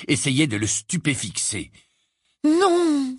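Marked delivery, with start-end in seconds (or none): 2.4-3.2 high, drawn-out cry of protest; cried out. A narrator's reading. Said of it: "s'écria Hermione".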